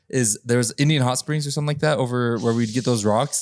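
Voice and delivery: ordinary delivery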